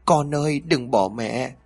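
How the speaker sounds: narrated cry of a desperate mother. A narrator's reading. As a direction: call after a child who is leaving, pleading. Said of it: "rồi hét lớn".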